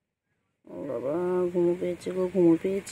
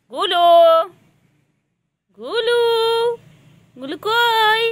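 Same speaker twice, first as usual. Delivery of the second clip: very high, louder searching call; 14 semitones higher, 8 dB louder, slower than usual. Real, not acted. Owner searching for her pet Gulu, very high calling. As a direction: call the pet's name up high, looking for it.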